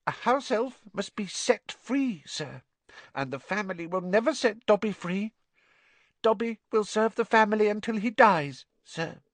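high, squeaky elf, mournfully dutiful; resigned house elf. Acted. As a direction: state your fate in a high little voice.

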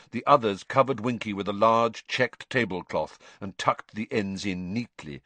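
usual speaking voice

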